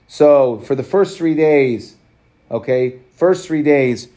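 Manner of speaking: 'first three days' drawn out slowly, stressing 'first'; teacher stressing 'first three days' slowly; slow emphasis. Shown as a demonstration. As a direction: lean on 'first' and take it slow. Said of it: "teacher stresses the time span".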